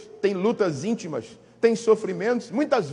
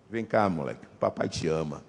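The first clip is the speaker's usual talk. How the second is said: much lower and softer, tender dad; tender father; 8 dB quieter than usual. Retold or imitated. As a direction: soften into love for the child, deep and gentle. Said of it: "você diz assim". (pai carinhoso, voz bem grave e suave)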